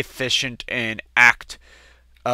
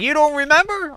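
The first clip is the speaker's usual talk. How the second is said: dad's teasing question. Retold or imitated. Dad teasing son.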